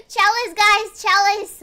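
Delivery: loud insistent "Chalice"; emphatic correction. Shown as a demonstration. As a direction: drive home "Chalice" for chat.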